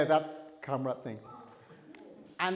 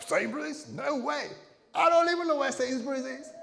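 higher, louder indignant suspect; incredulous denial; 11 semitones higher, 4 dB louder than usual. Acted. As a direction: act shocked at the accusation. Suspect denies the theft.

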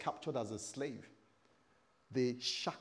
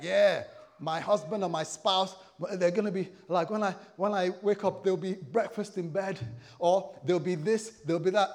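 loud, higher, gushing newlywed; gushing anticipation; 5 semitones higher, 8 dB louder than usual. Acted. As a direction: pile up romantic hopes excitedly. Acts out hopeful newlywed.

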